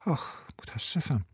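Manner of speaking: soft, slow groan; hushed, drawn-out moan of regret; groaning apology. A narrator's reading. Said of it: "لیو نالید".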